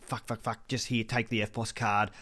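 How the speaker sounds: soft hurried mutter; flustered muttering. Retold or imitated. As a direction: mutter it under your breath. Flustered muttering while paying.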